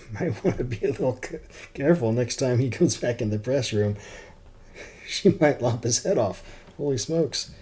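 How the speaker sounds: chuckling while speaking; amused laughing. Real, not acted. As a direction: talk through real laughter. Reader amused commentary.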